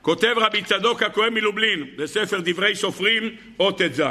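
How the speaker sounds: ordinary delivery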